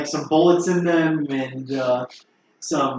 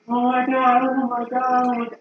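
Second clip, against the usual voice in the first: distressed man; 9 semitones higher than usual. Retold or imitated. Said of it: "just going like".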